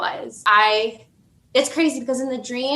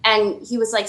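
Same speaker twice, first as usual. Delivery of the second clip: usual speaking voice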